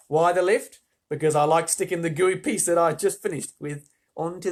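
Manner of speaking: put-on bragging, excitable Australian girl; Australian gum-chewer. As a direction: boast away in a lively Australian accent. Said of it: "in an Australian accent".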